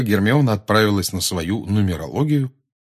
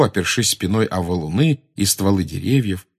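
ordinary delivery

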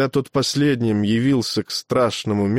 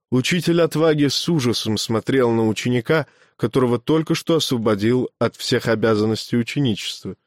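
plain reading voice

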